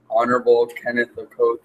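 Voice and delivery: ordinary talk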